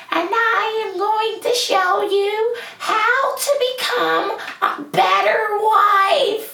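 very high, singsong podcaster voice; mocking own recorded voice. Podcaster mimicking how the recording sounds, high mocking squeal. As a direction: squeal it out high and drawn-out like a parody host. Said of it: "when you hear yourself, you hear, like".

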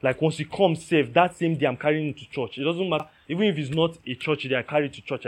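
loud, fast preacher's fervour; preaching at full force. Real, not acted. Preacher vowing to his people, loud and fervent. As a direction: pour it out at the congregation, loud.

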